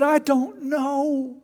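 high, slow and helpless; John Piper voice. Retold or imitated. Piper answering his wife, high and bewildered. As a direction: let it hang up high and lost. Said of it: "he's got a high-pitched voice".

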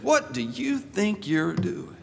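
high, slow, accusing policeman; stern challenge. Retold or imitated. Policeman stopping a driver, slow and high accusing tone. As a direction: put the driver on the spot. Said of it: "they always start with that tone".

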